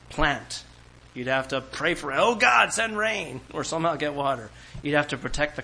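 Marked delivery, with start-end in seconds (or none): 2.1-3.4 higher, louder plea to God for rain; imitated farmer's prayer. Preacher voicing an ancient farmer begging God, mock plea. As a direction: cry out to God like a worried farmer.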